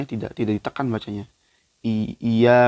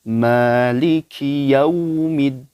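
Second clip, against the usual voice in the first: slow, louder melodic Quran recitation; 3 semitones higher, 7 dB louder, much slower than usual. Acted. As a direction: chant the verse slowly like tilawah. (bacaan tilawah pelan dan lantang)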